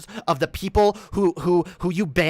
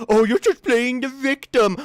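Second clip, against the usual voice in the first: higher-pitched mock accusation; mocking imitation; 5 semitones higher than usual. Streamer mimics opponent's reply when called out, mocking. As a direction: throw it back in a put-on taunting voice. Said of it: "then when I call it out".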